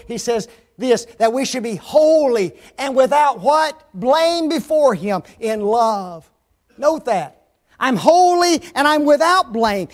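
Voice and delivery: loud, rousing scripture preaching. Real, not acted. Preacher declaring himself without blame, forceful preaching.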